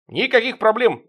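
loud eager report, high; bark like a soldier. Acted. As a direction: snap out a report like a private. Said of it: "отрапортовал Шоколадный". (бодро рапортует)

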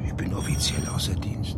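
soft whispered answer, no voice. Acted. Off-duty officer answering her secretly, whispered.

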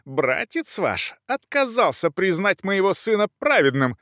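high-pitched outraged complaint; affronted countess accusing, high; indignant. A narrator's reading. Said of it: "высказывать своё возмущение".

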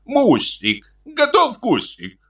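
high-pitched wail without much volume; hungry husband's mock yell. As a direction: cry out plaintively in a high voice. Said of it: "завопил".

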